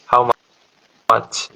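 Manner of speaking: ordinary talk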